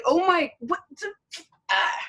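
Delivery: high-pitched retold exasperation; re-enacted speechless outrage, breaking off; exasperated. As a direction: sputter as if lost for words. Retold speechless reaction to roommate.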